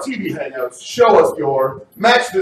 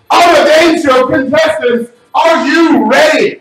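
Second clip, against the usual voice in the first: hyped up; 10 semitones higher, 8 dB louder, slower than usual. Acted. Host hypes contestants.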